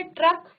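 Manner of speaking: ट्रक with half ट flowing into full र; teacher models ट्रक; demo word. Shown as a demonstration. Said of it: "ये ट आधा है".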